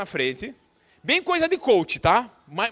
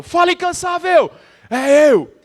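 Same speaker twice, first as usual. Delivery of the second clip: higher, much louder rally call then answer; call and response shout; 8 semitones higher, 10 dB louder, slower than usual. Shown as a demonstration. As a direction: hype the crowd with a demo. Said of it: "Ó, eu falo". (demonstração de grito de guerra, bem alto)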